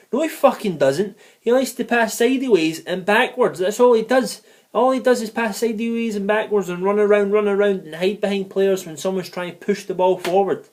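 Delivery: high, loud, exasperated tirade; furious rant. Real, not acted. Angry rant about Joe Allen.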